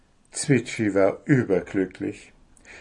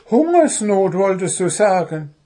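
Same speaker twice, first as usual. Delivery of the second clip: high, loud bird correction; flaring-up little bird; 10 semitones higher, 6 dB louder than usual. A narrator's reading. Hungry bird corrects the dwarf, flaring up. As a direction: snap back in a high bird voice. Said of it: "brauste Zwitschi auf".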